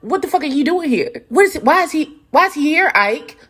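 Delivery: fluster at the uninvited guest; upset Brandon. Retold or imitated. Brandon demanding why Carl came, high and flustered. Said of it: "And like".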